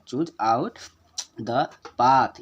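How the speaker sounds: "Choose out the path" in separate beats, "path" loud and held; stress on path. Shown as a demonstration. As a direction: scan it word by word, landing hard on "path". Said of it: "थोड़ा देर के लिए इसको पढ़ें". ("path" पर ज़ोर देकर, थोड़ा लंबा)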